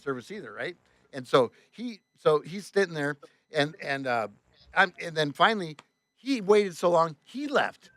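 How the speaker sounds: usual speaking voice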